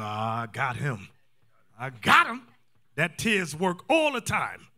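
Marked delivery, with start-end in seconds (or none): 0.0-1.1 low, slow, drawn-out aside; sly wife. Retold or imitated. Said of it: "She go". 1.8-2.5 louder, open mock triumph; gloating wife. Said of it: "She go".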